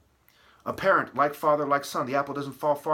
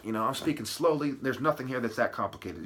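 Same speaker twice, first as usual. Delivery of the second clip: usual speaking voice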